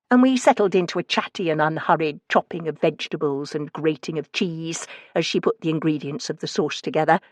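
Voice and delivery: plain reading voice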